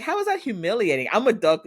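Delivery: high, quick, indignant mock outburst; incredulous protest. Daughter answering dad's humiliation remark, incredulous. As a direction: throw it back in high-pitched disbelief.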